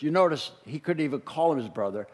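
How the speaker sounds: ordinary talk, nothing marked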